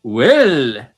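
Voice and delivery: loud Irish leprechaun "Well". Pretend. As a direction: bellow "Well" like a cartoon leprechaun. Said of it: "think of the leprechaun".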